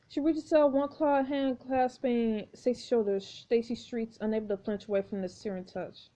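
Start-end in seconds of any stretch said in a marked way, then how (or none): none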